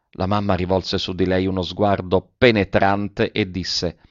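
ordinary delivery

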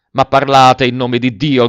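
loud, frantic cry; delirious shout. A narrator's reading. Alberto begging Velleda to speak, delirious shout. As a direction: shout it half out of your mind. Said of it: "gridò delirante".

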